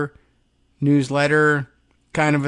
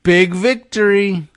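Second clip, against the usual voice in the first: loud, higher mock proclamation; all-caps shout; 6 semitones higher, 6 dB louder than usual. Host reading Trump's post, loud mock rally shout. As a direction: bellow it like a campaign rally. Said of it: "all caps".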